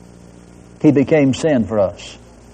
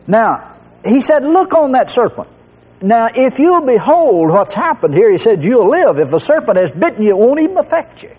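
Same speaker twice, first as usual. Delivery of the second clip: higher, driving preacher's cadence; fervent preaching; 8 semitones higher than usual. Acted. Preacher drives the point home to the congregation, fervent.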